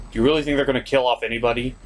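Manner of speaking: ordinary delivery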